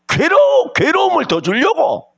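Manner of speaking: fervent high-pitched preacher's cry; preaching shout. Real, not acted. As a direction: shout it out in the sermon. Preacher voicing rivals' spite.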